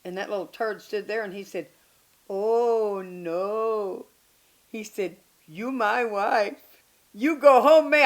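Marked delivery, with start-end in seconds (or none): 2.3-8.0 mock Filipino accent. Imitating the Filipino husband.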